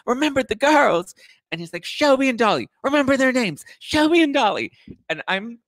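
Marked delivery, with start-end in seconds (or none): 0.1-1.1 high, loud imitation of a drunk man; hammed-up high-pitched plea; dramatic drunk wailing. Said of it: "I mean, so dramatic". 1.8-4.7 high, loud imitation of a drunk man; hammed-up high-pitched plea; dramatic drunk wailing. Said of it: "I mean, so dramatic".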